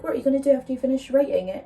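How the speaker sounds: quoting dad. Retold or imitated. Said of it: "my dad said, he was like".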